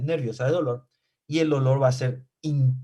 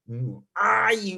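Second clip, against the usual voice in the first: high little "mm, ¡ay!" after a hammer blow; mock cry of pain; 8 semitones higher than usual. Teacher mimics a hammer blow to the belly, a high mock "¡ay!". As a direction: yelp "¡ay!" as if hit by a hammer. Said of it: "eso le va a decir".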